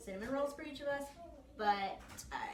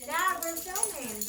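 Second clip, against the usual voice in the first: calling out; 7 semitones higher, 8 dB louder, slower than usual. Real, not acted. Calling out to interrupting dad.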